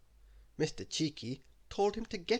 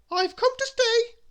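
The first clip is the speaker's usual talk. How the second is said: very high, loud, slow announcement; high-pitched bossy proclamation; Little Miss Bossy; 11 dB louder than usual. A narrator's reading. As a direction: announce it bossily at full voice. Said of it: "announced Little Miss Bossy".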